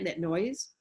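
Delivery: ordinary talk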